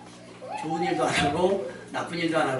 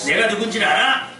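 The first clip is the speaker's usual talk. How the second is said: mock arrogant demand; 4 semitones higher, 6 dB louder than usual. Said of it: "협박한단".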